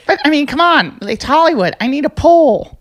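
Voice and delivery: high mock whiny young woman; imitating a whiny girl. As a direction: pitch it way up like a spoiled girl complaining. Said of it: "And she goes".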